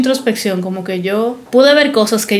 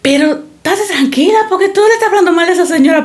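scolding sister; 7 semitones higher, faster than usual. Retold or imitated. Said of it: "mi hermana me dijo".

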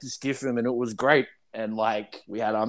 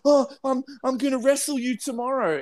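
mock eager fan; 11 semitones higher than usual. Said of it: "he's like".

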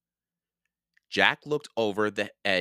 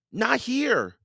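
high, loud warning; warning shout; 5 dB louder than usual. A narrator's reading. Annie waving at swimming wildebeests, shouting. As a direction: shout them away, pitched way up. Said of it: "she shouted".

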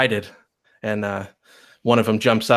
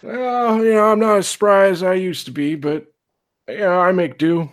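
put-on higher, steady old lawman; old marshal; 7 semitones higher than usual. Old marshal facing down the preacher, firm and high. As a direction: calmly overrule the preacher, slowing at the end.

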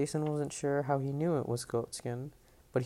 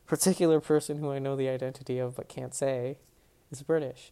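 plain reading voice

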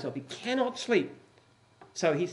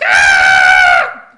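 furious low shout; 21 dB louder than usual. Acted. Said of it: "commandant shouting in fury".

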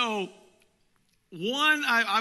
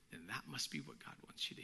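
whispered; 16 dB quieter than usual. Retold or imitated. Comforting grieving friend on phone.

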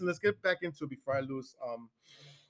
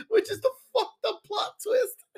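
laughing; much slower than usual. Real, not acted. Cracking up at the plot twist.

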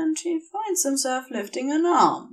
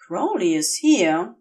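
ordinary delivery

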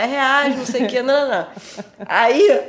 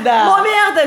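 louder, higher mocking jab; 7 semitones higher, 7 dB louder than usual. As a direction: sneer it out loud. Mocking the joker dad.

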